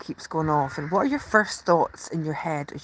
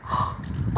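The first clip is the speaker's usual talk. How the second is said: gasp; 3 dB quieter than usual. Real, not acted. Spotting the birds.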